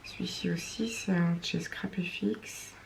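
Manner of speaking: whispered aside to herself; whispering. Real, not acted. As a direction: breathe it out under your breath. Muttering to herself while crafting.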